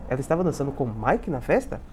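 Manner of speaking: "festa" turned upward as a question; question intonation. Shown as a demonstration. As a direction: show the question tune, rising on "festa". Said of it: "tem que fazer a entonação". (entonação de pergunta, subindo em "festa")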